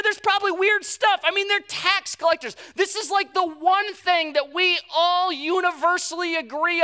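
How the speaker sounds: loud, high, outraged crowd tirade; up in arms. Acted. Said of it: "they're up in arms going".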